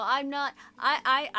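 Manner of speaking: usual speaking voice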